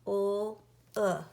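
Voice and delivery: "O-O" as two sounds, held L, then short oo; sounded out. Shown as a demonstration. Teacher sounding out start of look, sounded out. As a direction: say "O-O" as lll, pause, uh. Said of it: "uh can be spelled O-O".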